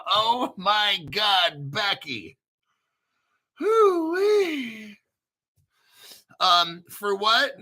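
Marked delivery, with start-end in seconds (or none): 3.6-5.0 high hollering hoo-wee; delighted yelp; excited whoop. Real, not acted. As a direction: let out a gleeful holler. Excited whoop over big news.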